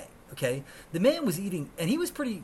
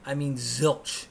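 ordinary talk, nothing marked

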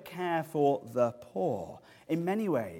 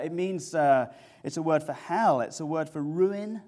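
normal talking voice

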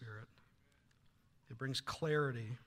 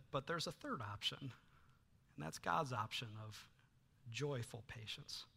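calm advice; 4 semitones lower, 10 dB quieter than usual. Retold or imitated. Husband's wise advice.

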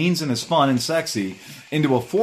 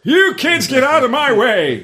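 hammed-up cranky old man shout; grumpy old man yelling; 12 semitones higher, 6 dB louder, slower than usual. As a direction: yell like a cranky old geezer. Mock grumpy old biker.

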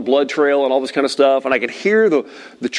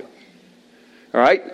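ordinary delivery

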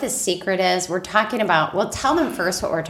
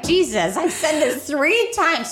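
high-pitched, drawn-out exasperated complaint; comic fed-up spouse, pitched up; mock exasperation; 8 semitones higher, slower than usual. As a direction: act fed up with repeating yourself. Acts out worn-out spouse repeating.

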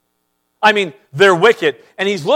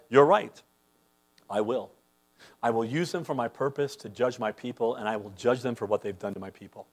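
soft voice of God; 8 semitones lower, 11 dB quieter than usual. Acted. God voiced answering softly.

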